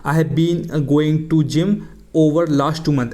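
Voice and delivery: slow, deliberate example sentence; slow model sentence. Shown as a demonstration. Teacher dictating the wrong example slowly to students. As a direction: read each word slowly like a teacher. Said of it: "ऐसा बोलूँगा".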